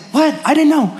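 high startled what, I didn't know; squeaky mock surprise. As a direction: play the caught-off-guard churchgoer. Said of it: "so you don't come and say".

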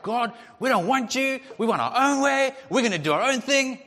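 defiant rebels. Acted. People rejecting God, loud mock defiance. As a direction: stamp your foot at heaven.

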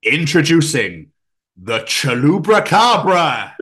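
loud, slow grand reveal; dramatic announcer. Retold or imitated. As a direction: announce it like a trailer voice.

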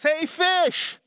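hammed-up fisherman bellowing to fish; loud call to fish. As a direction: shout down into the water at the fish. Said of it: "call out in a loud voice".

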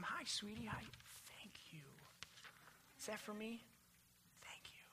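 hushed, gentle words to a little one, thanks half whispered. Real, not acted. Pastor aside to a child.

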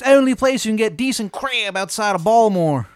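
loud, high, exaggerated Maryland twang; terrible Maryland accent. Baba Yaga greeting a newcomer, bad Maryland accent. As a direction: holler in a hammed-up Maryland accent. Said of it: "accent is like nails on a fucking chalkboard".

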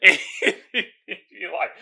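normal talking voice

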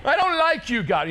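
high loud wail at God; mock anguished cry. Pastor mimicking a believer crying out at God, high and loud. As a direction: cry out, hammed-up despair. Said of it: "something doesn't work out".

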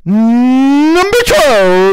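loud, high, hugely drawn-out arena announcer; PA announcer at full yell. Pretend. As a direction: bellow the number, stretching it out. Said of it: "Do a frickin' announcer voice".

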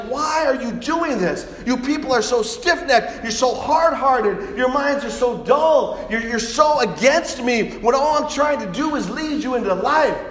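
high, slow, drawn-out scolding; God's loud, frustrated reproach; exasperated rebuke. Acted. God rebukes the stiff-necked people.

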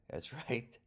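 chuckling through the words; amused, laughing delivery. Real, not acted. Repeats the punchline twice.

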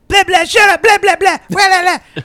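very high, loud gibberish; hyper kid. Retold or imitated. Hyper kid jabbers gibberish at Voha, very high and loud. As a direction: babble like a wound-up child. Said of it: "And he's like".

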